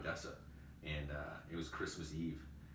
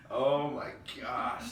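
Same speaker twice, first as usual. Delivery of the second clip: slow, stunned oh my gosh, pitched up and louder; aghast; 6 semitones higher, 8 dB louder, much slower than usual. Retold or imitated. A manager seeing his store emailed the whole company, aghast. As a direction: let it sink in with horror. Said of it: "about to have a freaking aneurysm".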